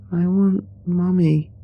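put-on high, slow child's whine; drawn-out, high plaintive plea; tearful little girl. A narrator's reading. Little girl asking the officer for Mummy, tearful high voice. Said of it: "she said, tearfully".

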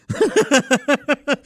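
a burst of high laughter. Real, not acted. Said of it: "I laughed".

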